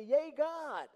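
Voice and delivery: loud, high cheerleader whoop; mock cheer. Preacher mocks what Israelites should have said, cheering. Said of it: "put on a cheerleader suit".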